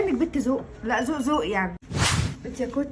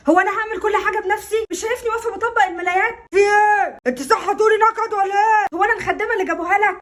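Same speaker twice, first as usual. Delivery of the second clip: exasperated yelling; 2 semitones higher than usual. Acted. Wife yells at husband.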